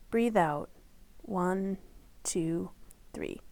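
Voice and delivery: fading exhale count; breathe out count. Acted. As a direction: let the count sink as the breath goes out. Leads a breathing exercise.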